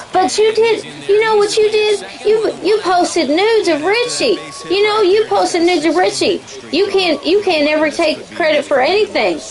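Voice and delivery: high-pitched accusing impression; Little Mama. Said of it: "that's Big Mama or, or Little Mama".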